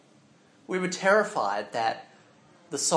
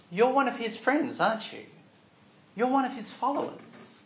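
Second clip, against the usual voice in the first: higher, pointed accusation; a bystander jabbing at him, higher; lightly voiced accuser, higher pitch; accusing stranger; 8 semitones higher than usual. Strangers accusing Peter by fire.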